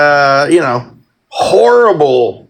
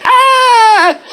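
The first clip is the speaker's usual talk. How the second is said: anguished cry. Retold or imitated. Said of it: "and it's like".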